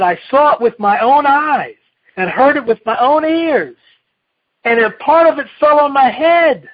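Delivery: a chick swearing it's true, rising in alarm; frantic Chicken Little. Acted. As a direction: insist you saw it, panicky. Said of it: "Chicken Little said".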